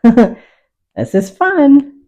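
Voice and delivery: giggling through the words, drawn out and high; real delight bubbling over at the canvas; laughing. Real, not acted. Painter delighting in her brushwork, laughing as she talks.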